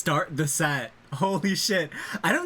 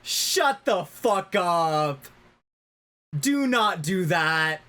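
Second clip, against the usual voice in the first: yelling at the screen; 4 semitones higher, slower than usual. Real, not acted. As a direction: shout it at the TV, furious.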